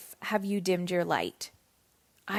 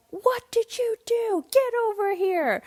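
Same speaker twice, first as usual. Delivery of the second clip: high-pitched mock-angry parent; strict parent scolding; 11 semitones higher than usual. Old-school mother calling a misbehaving son over, high mock scolding. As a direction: put on an outraged parent's voice, pitched way up. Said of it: "old me, or maybe an older generation".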